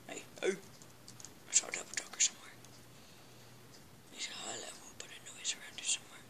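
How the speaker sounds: soft, breathless whisper; sneaky whisper. Acted. Gamer hunting a cog whispers to viewers, soft whisper. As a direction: hush it like stalking prey.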